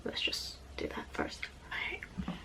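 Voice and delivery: whisper. Real, not acted. Whispered while eating.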